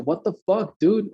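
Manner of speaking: heated, disbelieving retort; angry retort. Retold or imitated. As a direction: retell an incredulous blow-up at someone. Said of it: "I told him like that".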